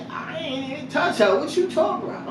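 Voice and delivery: put-on high boy's indignant denial; imitating son denying. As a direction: whine that you did nothing. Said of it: "He like".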